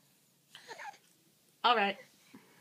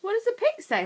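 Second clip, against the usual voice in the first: normal talking voice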